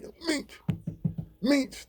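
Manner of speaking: high, breathy mock deaf call; mimicking Habao. Deaf inmate Habao getting Meech's attention, high mock voice. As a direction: call the name high and soft, tapping. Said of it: "he like".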